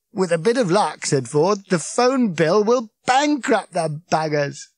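Ford, gleeful. Acted. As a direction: savour the punchline.

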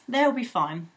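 usual speaking voice

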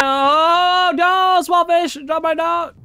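high, loud drawn-out wail; mock horror. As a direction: cry out in exaggerated dismay. Mock grief at game death.

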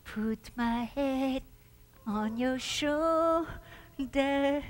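very slow, soft and intimate; tender murmur. Acted. John speaking lovingly to Jesus, soft and slow. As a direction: breathe it tenderly.

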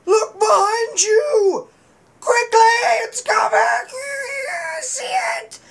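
high frantic shout; panicked warning. Pretend. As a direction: cry out in mock terror.

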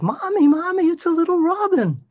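put-on high, excited little-girl voice reading to kids; storybook voice, very high and bouncy; childlike voice. Shown as a demonstration. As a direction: squeal it like a baby bird for a toddler. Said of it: "the way we read to children is we inflect all over the place".

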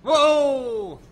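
loud, high drawn-out exclamation; startled. Real, not acted. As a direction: yell out in amazement. Reacting to in-game action.

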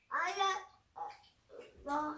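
talking with gross candy in mouth; words round a bad-tasting bite; mouth full. Real, not acted. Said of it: "I think I need to spit it out".

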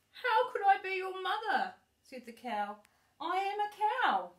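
high and loud, booming cow; big cow voice. Acted. The cow telling the baby bird it is no mother, loud and high.

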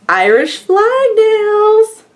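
high, slow and loud, stretching out the words; thrilled, drawn-out exclamation; delighted reveal. Real, not acted. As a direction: announce the gift with real delight. Delighted reveal of gift nails.

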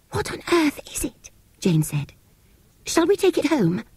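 put-on high, wondering little girl; a small child asks in awe; young girl voice. A narrator's reading. Small Jane.

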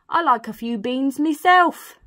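storybook burglar, big and loud; Burglar Bill. Acted. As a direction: declare it heartily, drawing it out. Burglar chats to the baby.

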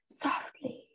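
breathy hushed whisper, barely voiced; scared whisper. Man praying on a plane, afraid of being heard, whispering. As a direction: whisper it so nobody nearby hears. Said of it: "He was so scared someone would hear".

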